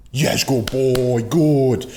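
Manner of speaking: put-on high, loud, drawn-out praise for a good boy; praising the dog. Shown as a demonstration. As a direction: cheer the dog on, slow and bright. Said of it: "And we reinforce".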